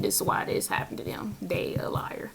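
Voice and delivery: whispered spirit message; relaying what the spirits tell her in a whisper. Retold or imitated. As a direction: hush it like a secret.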